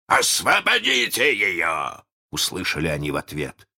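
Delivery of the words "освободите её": протяжный повелительный окрик; прикажи издалека, высоко и медленно; капитан Крюк. A narrator's reading. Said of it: "голосом капитана Крюка". (властный крик)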